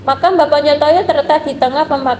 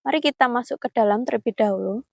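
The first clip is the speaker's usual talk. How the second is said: hushed, careful vlog voice; nervous night vlog whisper; 5 dB quieter than usual. Real, not acted. Hushed talk at the grave.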